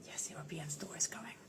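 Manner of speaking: hushed rushed whisper; soft fast whisper. Acted. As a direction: breathe it like a secret. Teasing tour news again.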